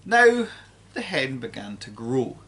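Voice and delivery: ordinary delivery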